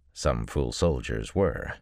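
ordinary delivery

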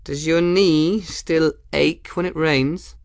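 high, slow, sugary taunt; predatory sweetness. A narrator's reading. Ransom taunting the hostile Kettle, high and slow. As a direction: purr the question to needle him. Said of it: "something quite predatory came into her smile".

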